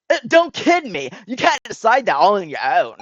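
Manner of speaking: loud, heated objection; indignant outburst. Acted. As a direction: burst out against the decision, loudly. Character objects to the decision.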